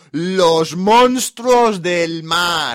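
"los monstruos del mar" shouted slowly, every word stretched; spelled out in capitals. Retold or imitated. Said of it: "lo ha puesto en mayúscula y letra a letra".

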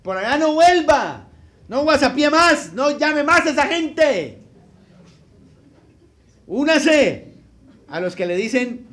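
loud, rising preacher's warning; preaching at full force. Retold or imitated. Preacher warning the congregation about sin, loud and fervent. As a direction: thunder the warning from the pulpit.

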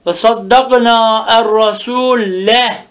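slow recitation, clear final "e" on "rasule"; audible fetha ending. Shown as a demonstration. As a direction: draw out "rasule" and land on its open e ending. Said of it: "bil fethati zahira". ("rasule" sonundaki üstünü belirgin, ağır ağır oku)

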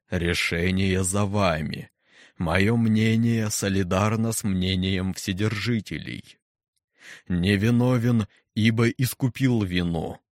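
slow, booming, impassive question; menacing deep voice. Acted. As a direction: let the words fall into silence. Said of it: "глубокий, низкий, бесстрастный".

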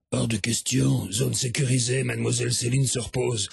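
low, gruff bouncer voice; stern guard. Acted. Guard refuses the fans at the hotel door, low and stern. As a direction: block them like a stone-faced guard.

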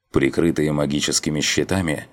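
plain reading voice

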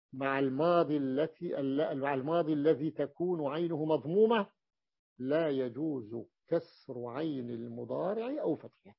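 ordinary talk

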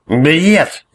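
put-on high, loud drunk bellow; drunken roar. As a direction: roar it out, tipsy and furious. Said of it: "пьяно взревел жрец".

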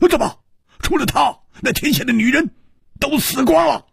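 high, incredulous yelling; scornful, high-pitched outburst; outraged shouting. A narrator's reading. Outraged man berates the husband.

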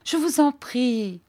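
high, drawn-out entreaty; imploring, stretched-out words; pleading. A narrator's reading. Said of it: "dit-elle d'un ton suppliant".

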